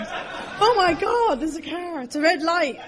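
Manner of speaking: high, loud, drawn-out cry of alarm; mock panic. Passenger to driver after near misses, mock panic.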